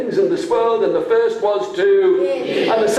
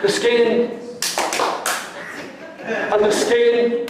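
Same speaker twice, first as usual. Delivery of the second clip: slow drawn-out prompt; 4 semitones higher, slower than usual. Shown as a demonstration. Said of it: "I'm doing it".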